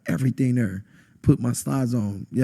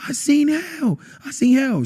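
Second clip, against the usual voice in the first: very high-pitched frantic cry, little added volume; retold screaming; 13 semitones higher, slower than usual. Man waking his girlfriend after hell, mock scream. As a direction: re-enact screaming it in panic. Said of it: "I'm screaming at her".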